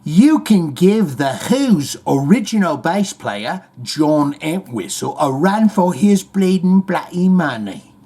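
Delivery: hammed-up English accent, slow and drawn out; exaggerated British impression. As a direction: do a broad mock English rocker. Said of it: "without doing an English accent, he said".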